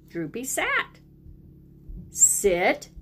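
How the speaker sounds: usual speaking voice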